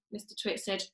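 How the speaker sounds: ordinary delivery, nothing marked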